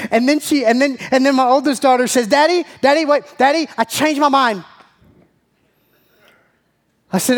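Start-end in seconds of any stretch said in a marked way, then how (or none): 2.3-4.6 higher-pitched imitation of an eager child; urgent interjection. Daughter interrupting dad with a change of mind, urgent. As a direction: cut in urgently, lightly acting a child.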